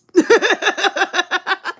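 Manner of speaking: real laugh breaking out; burst of laughter. Real, not acted. Streamer cracking up at a comment, laughing hard.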